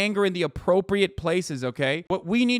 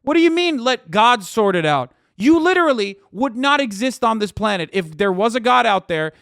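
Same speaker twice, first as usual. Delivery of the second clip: yelling in real rage, much higher and louder; furious shout; 4 semitones higher, 6 dB louder than usual. Real, not acted. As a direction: blast them with fury. Furious rant continues.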